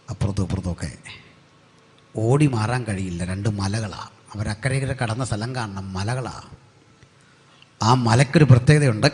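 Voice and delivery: ordinary talk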